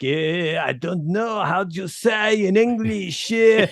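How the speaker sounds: slow, mock French accent; hammed-up, drawn-out French-accented English; [name]. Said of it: "an English guy went to France".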